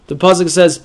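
usual speaking voice

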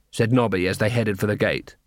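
usual speaking voice

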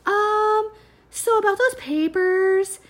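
high, sweet, overly polite ask. Retold or imitated. Retold timid request.

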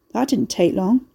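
plain reading voice

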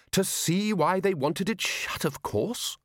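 as if it were obvious. Acted. Ridcully answers, voiced.

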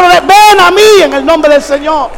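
slow, high, declaring command; commanding call. Acted. Preacher commanding the miracle to come, slow proclamation. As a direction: call the miracle forth with authority.

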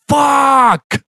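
imitated high-pitched cursing yell; Rowan. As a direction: mimic a high, sudden outburst. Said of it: "and Rowan goes".

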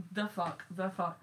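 usual speaking voice

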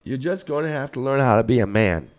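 usual speaking voice

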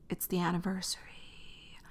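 breathy hushed whisper. Real, not acted. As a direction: drop to a secret whisper. Solemn aside about covid.

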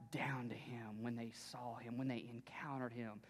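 hushed, breathy preaching whisper. Acted. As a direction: drop to a reverent whisper.

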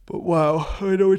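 talking through a yawn. Real, not acted. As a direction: yawn right through the words. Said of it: "I'm sorry for yawning".

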